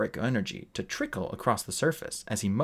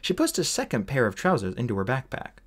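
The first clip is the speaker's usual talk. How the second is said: ordinary delivery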